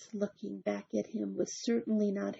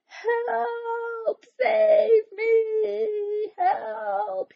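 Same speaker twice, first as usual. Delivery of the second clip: much higher, desperate cries; crying for help; 13 semitones higher, 5 dB louder, much slower than usual. Acted. Big Anthony stuck up the tree, much higher cries for help. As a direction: scream for rescue from the treetop. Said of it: "he cried".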